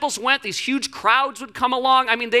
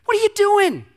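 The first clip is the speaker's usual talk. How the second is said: mock scolding; 12 semitones higher, 4 dB louder than usual. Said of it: "he really starts letting Jesus have it".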